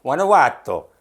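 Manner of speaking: Guanajuato with a lilting Italian flavour; Italian-sounding. Shown as a demonstration. Asked to say the place name, an Italian-sounding Guanajuato. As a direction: roll Guanajuato out like an Italian word. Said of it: "No, that sounded Italian".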